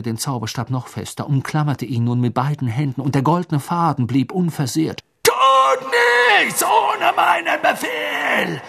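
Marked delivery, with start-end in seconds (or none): 5.2-8.6 high, furious-sounding screamed order; Voldemort screaming. A narrator's reading. Voldemort warns the Death Eaters to await orders, screaming. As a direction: scream the command, stretching it out. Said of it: "schrie Voldemort den Todessern zu".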